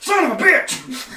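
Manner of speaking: high, loud, drawn-out yell; frustrated outburst. Retold or imitated. Player reliving losing his unsaved character, exasperated yell. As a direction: curse at the top of your voice, stretching it out. Reliving the lost save, cries out.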